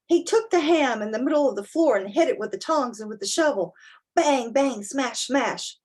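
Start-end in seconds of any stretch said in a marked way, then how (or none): none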